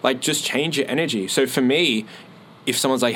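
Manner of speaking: normal talking voice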